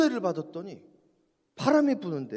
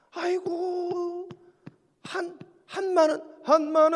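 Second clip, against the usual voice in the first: mock wail; 7 semitones higher, 4 dB louder, slower than usual. Wailing about Korean han.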